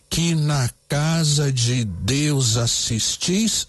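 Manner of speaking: very slow, every syllable spaced out; measured scansion read; syllable counting. Shown as a demonstration. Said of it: "vamos contar".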